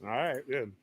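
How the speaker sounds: talking around a gummy; mouth full. Real, not acted. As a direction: chew while you speak. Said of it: "I have a gummy in my mouth".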